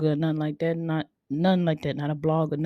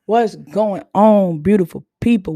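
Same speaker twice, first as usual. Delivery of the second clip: big greeting; 2 semitones higher, 8 dB louder than usual. Real, not acted. Podcast host opening greeting.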